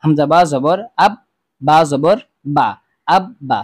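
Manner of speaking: বা held and doubled in আব বা, slow; doubled letter held. Shown as a demonstration. Teacher shows students how আব বা doubles the বা, held. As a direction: hold the বা of আব বা twice. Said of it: "তাসদিদ ওয়ালা হরফ সবসময় ধরে রাখতে হয়".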